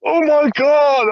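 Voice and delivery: high, loud, drawn-out mock panic. Storyteller imitating the caught shoplifter, panicked wail. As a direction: imitate a terrified thief getting caught. Retold thief caught and slammed.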